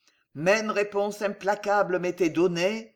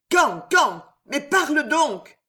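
loud, impatient, a bit higher; urgent demand; 7 semitones higher, 5 dB louder, slower than usual. Acted. The queen pressing Ruggieri loudly and impatiently. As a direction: hammer him with the question.

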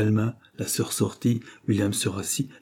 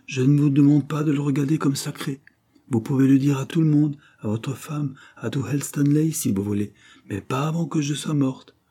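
higher, urgent plea; insistent; 6 semitones higher, 4 dB louder than usual. Acted. Sick woman pressing William for a promise, insistent. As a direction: press him in a raised voice. Agitated patient pressing her visitor.

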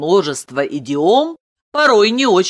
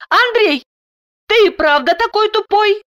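high-pitched incredulous wife; amazed; 4 dB louder than usual. A narrator's reading. Wife asks whether husband is really that dense, amazed. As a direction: ask in high-voiced amazement. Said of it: "с изумлением и интересом".

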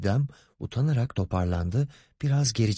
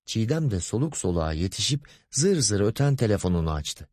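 usual speaking voice

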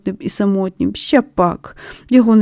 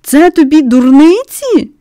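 put-on loud, high indignant boy; outraged shout; 6 semitones higher, 5 dB louder than usual. A narrator's reading. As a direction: hurl it back, offended and loud.

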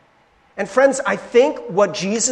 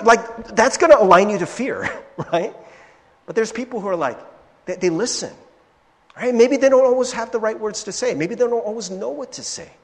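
usual speaking voice

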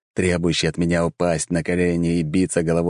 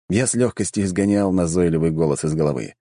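ordinary delivery

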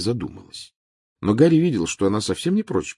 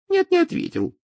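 Trelawney voice; 4 dB louder than usual. Acted.

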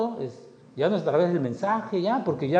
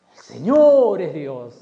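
slow, loud proclamation; loud acclamation; 8 dB louder, much slower than usual. Acted. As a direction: proclaim it slowly to the crowd. People's acclamation in story.

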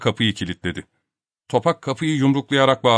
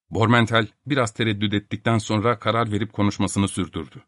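ordinary delivery, nothing marked

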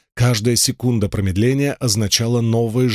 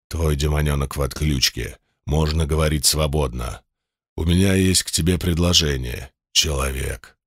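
insinuating sinister voice; 6 semitones lower than usual. Acted. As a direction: purr it low like a sinister giant demon. Demon boss voice held.

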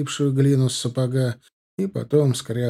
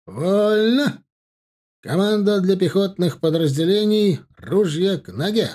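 high, loud parade command; shouting drill sergeant; 7 semitones higher, 4 dB louder than usual. A narrator's reading. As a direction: bellow the order across the field.

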